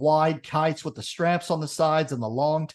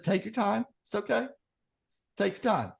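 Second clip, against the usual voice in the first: reassuring; 5 semitones higher, 6 dB quieter than usual. Acted. Farmer calmly reassures the businessman.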